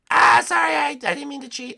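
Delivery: very high put-on player voice; mock apology. Rival apologises for cheating, high-pitched mock apology.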